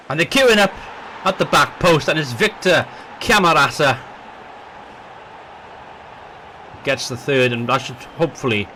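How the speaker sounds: football commentator calling the match; live match commentary, building with the chances; play-by-play commentary. Acted.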